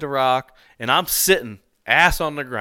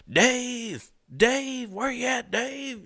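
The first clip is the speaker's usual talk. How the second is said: imitated holler; 11 semitones higher, 5 dB quieter, much slower than usual. Hunter mimicking a stranger calling Dave in the woods, high soft holler. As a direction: mimic a man hollering for his friend, softly. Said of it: "I hear this guy screaming".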